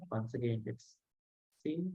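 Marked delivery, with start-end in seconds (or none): none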